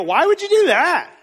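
high, loud mock disbelief; hammed-up affronted question; acted incredulous objection. Critics challenge Jesus over chasing the one, incredulous.

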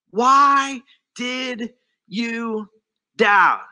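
very drawn-out, each word set apart; slow, pointed question. Retold or imitated. Jesus grabbing Peter from the water, slow, pointed question. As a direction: stretch each word, land hard on the last.

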